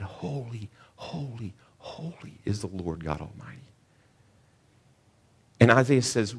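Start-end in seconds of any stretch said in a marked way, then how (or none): 0.0-3.6 much softer reverent hush on holy holy holy. Acted. Preacher voicing the angels around the throne, hushed. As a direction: breathe it softly, like awe before the throne.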